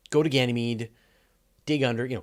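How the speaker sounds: ordinary talk, nothing marked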